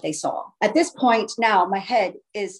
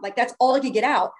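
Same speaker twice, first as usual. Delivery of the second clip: ordinary delivery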